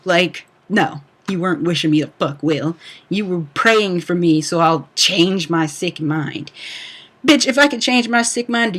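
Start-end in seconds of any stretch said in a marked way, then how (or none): none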